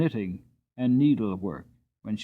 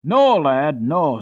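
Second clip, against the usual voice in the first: firm reassurance; 4 semitones higher, 9 dB louder, much slower than usual. A narrator's reading.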